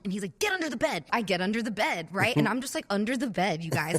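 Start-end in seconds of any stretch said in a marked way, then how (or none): 0.4-1.0 higher-pitched urgent repeat; acted panicked order. Friend tells her to hide, mock panic. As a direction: insist in a retold panic, pitch up.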